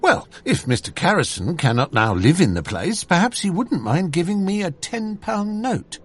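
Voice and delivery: clerk answering his employer with nervous boldness, speaking up a little; bold answer. A narrator's reading. Clerk asks employer for a ten-pound note, mildly bold. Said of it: "and I answered boldly".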